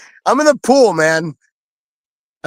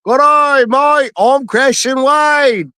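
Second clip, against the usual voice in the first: mock English accent; 7 semitones higher, much slower than usual. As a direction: ham up an English accent, stretching each word. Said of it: "He had the coolest English accent".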